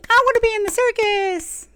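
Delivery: very high, squeaky clapping creature voice; excited little rat folk. Acted. Little rat folk bouncing in place, begging in a very high squeak. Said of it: "and it's clapping".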